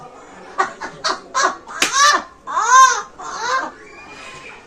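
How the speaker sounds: high, loud laughing shriek. Real, not acted. Influencer cracking up at her own lion and pyramid joke, shrieking laugh. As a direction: burst into a shrieking cackle.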